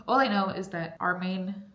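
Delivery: ordinary talk, nothing marked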